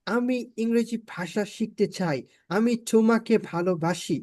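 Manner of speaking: slow mock foreigner's accent in Bengali; English-accented Bangla. Shown as a demonstration. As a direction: put on a native English speaker struggling through Bengali. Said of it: "ইংলিশের মতো করে বলে".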